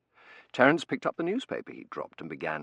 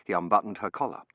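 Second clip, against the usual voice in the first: ordinary delivery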